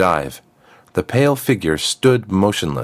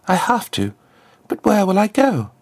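put-on much higher, lost girl's voice; uncertain girl. Freed girl wonders where she can go, high and unsure. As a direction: sound resolved, then lost.

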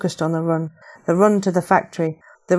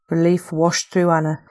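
usual speaking voice

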